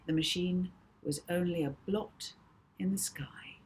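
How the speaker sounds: ordinary delivery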